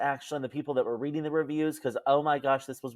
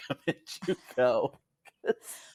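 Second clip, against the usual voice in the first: laughing; 3 semitones lower, 3 dB quieter than usual. Real, not acted. Laughing recommendation.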